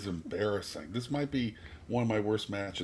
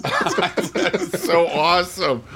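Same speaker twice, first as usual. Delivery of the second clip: delighted laughing; 7 semitones higher, 9 dB louder than usual. Real, not acted. Delighted by robot song.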